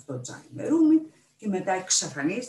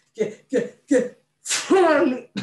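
sneezy stammer; 9 semitones higher, 10 dB louder, much slower than usual. A narrator's reading. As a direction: stutter building to a loud, high burst. Feverish captain stammers into a sneeze.

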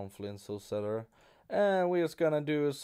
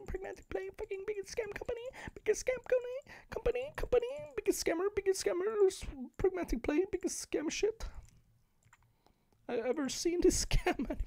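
mocking high voice; faster than usual. High squeaky mocking chant.

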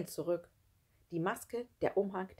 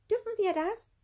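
very high, timid question; anxious; 4 dB louder than usual. A narrator's reading. Gerti asks if they are allowed, anxious high voice. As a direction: ask it high and fearful. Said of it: "fragte sie ängstlich".

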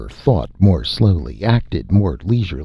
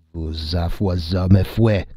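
very slow, drawn-out concession; mildly grudging admission, stretched out; reluctant admission; much slower than usual. A narrator's reading. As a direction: admit it slowly, in a changed voice. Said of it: "admitted the giant".

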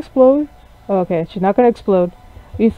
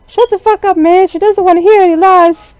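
put-on snappy high girl voice; 11 semitones higher, 5 dB louder than usual. Voicing Spider snapping at player.

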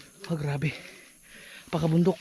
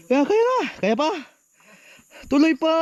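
pumped-up cheering; 7 semitones higher than usual. Real, not acted. Cheering himself up the climb.

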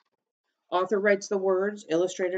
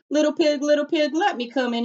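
higher, louder, sweetly faking a javelina; disguised coyote voice; 10 semitones higher, 5 dB louder than usual. Acted. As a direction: trick them by sounding like a friendly pig. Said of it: "make his voice sound like just another javelina's".

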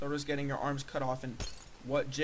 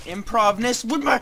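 frustrated outburst; 7 semitones higher, 10 dB louder than usual. Real, not acted. Frustrated outburst while playing.

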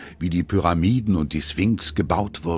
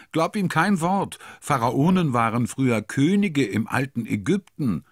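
put-on young girl voice; 8 semitones higher than usual. A narrator's reading. Child voice correcting brother.

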